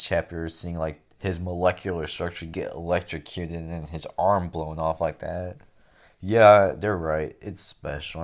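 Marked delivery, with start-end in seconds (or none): 6.2-7.4 loud concession. Real, not acted. Conceding the commenters were right.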